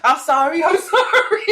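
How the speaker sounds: giggling sorry as you bump past; laughing apology. Retold or imitated. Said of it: "walking down the aisle like".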